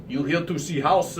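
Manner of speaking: lightly put-on Russian accent; a hint of a Russian homeowner at the door. Shirtless owner greeting the realtor in a light Russian accent. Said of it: "thick Russian accent".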